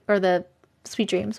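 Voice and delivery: ordinary talk, nothing marked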